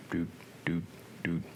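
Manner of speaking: soft, low "doot" sounds; idle dooting. Real, not acted. Scanning who is watching the stream, idly dooting. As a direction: doot softly to fill time.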